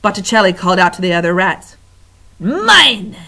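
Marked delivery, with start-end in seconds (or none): 2.4-3.1 louder, higher claim; louder possessive rat voice. Acted. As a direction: snap it out possessively. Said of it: "he said".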